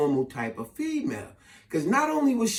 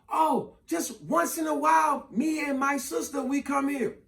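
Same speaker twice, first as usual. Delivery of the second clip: higher, softer female voice; imitated woman's reply; 6 semitones higher, 4 dB quieter than usual. Woman answering him in club, high imitated voice. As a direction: lightly call it back, pitched up.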